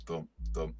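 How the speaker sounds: usual speaking voice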